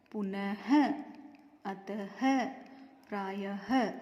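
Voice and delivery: पुनः, अतः, प्रायः each ending in a separate "ha"; पुनः, अतः, प्रायः in parts, landing on the h; sounded visarga. Shown as a demonstration. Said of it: "तो ह बोलने में आता है".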